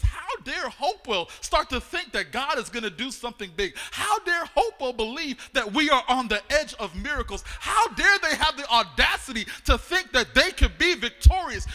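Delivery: loud, rising outrage as the devil; the devil scoffing at the church, in loud mock fury; devil's indignant rant. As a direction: rail at the church like an offended devil. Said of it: "And he says".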